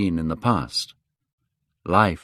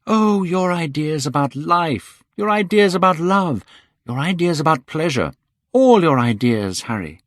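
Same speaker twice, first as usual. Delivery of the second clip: higher, rising louder through the list; playful youth, louder with each phrase; young Dorian, building; 9 semitones higher, 5 dB louder than usual. Acted. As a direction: pile up the ideas mockingly. Dorian lists Henry's ideas.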